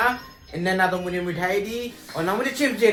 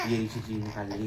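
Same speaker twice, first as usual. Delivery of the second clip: ordinary talk, nothing marked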